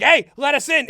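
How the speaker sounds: a guy losing patience yells to be let in; aggressive shout. Retold or imitated. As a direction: bang on the door and demand it. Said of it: "kinda the aggressive one".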